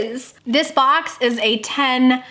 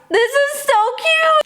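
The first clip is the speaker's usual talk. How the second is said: much higher cooing over the cute mask; gushing; 12 semitones higher than usual. Real, not acted. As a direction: coo at something adorable.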